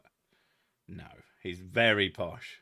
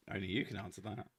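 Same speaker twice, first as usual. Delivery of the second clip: softer, quicker deadpan reply; quiet deadpan; 2 semitones lower, 9 dB quieter, faster than usual. Retold or imitated. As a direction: throw it away quietly. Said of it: "I'm gonna just".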